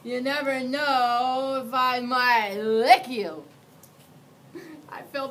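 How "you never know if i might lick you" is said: louder, slow hammed-up menace; mock terrifying monster. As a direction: lean in and try to frighten, not quite managing. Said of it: "trying to sound terrifying".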